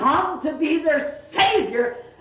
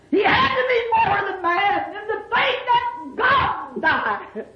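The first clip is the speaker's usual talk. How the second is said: high, crying sermon climax; impassioned preacher's high wail; fervent preaching; 8 semitones higher than usual. Real, not acted. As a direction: cry it out high, then draw it out. Preaches at full force.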